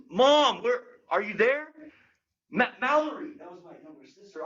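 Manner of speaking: high, searching call for mom; acted worried child calling through the house; mild panic, calling out. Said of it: "I started to panic a little bit".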